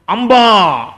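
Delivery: loud, imitated moo; cow's cry. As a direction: bellow like a cow answering a call. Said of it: "అని అరిచేదిట". (ఆవు అంబా అరుపు)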